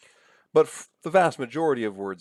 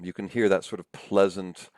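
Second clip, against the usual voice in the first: usual speaking voice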